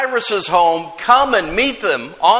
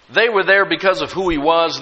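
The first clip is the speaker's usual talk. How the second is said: ordinary delivery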